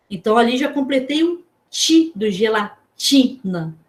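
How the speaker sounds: ordinary talk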